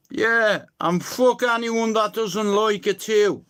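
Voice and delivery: ordinary talk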